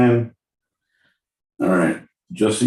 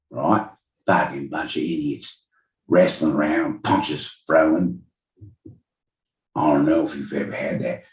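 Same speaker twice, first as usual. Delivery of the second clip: put-on lower, rough Aussie bloke accent; Australian accent; 6 semitones lower than usual. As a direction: play a brawling Australian larrikin. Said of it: "this question is for Australian".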